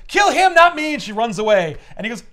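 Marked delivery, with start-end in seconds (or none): 0.1-0.9 much higher and louder; panicked demand. Retold or imitated. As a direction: shriek it selfishly. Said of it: "And then she goes".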